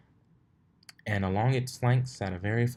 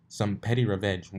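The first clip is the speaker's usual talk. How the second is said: ordinary delivery, nothing marked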